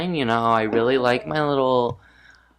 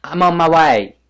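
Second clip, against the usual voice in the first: louder, higher imitation with a hint of British accent; mock Imani line; 5 semitones higher, 8 dB louder than usual. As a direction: play the confident islander on her way. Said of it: "And she goes".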